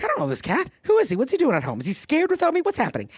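hammed-up fretting, jumping high on questions; worried. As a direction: act out anxious questions, pitch leaping. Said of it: "I worried about this cat".

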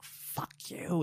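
held-back mock scream in a hush; breathy, muted mock yell; retold scream. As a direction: imitate a screamed curse without the volume. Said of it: "and, like, screaming".

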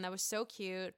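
normal talking voice

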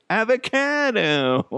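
high, drawn-out "avocado" as "ava-CAA-no"; horrible avocado pronunciation. Retold or imitated. Ordering a burger like Salt Bae, "avocado" stretched high with a cat vowel. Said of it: "horrible way to say avocado".

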